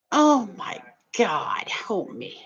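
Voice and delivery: breathless scared whisper, slowed down; mock-frightened aside under the breath. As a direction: hush it like you're begging for rescue. Pretending to be scared on bus.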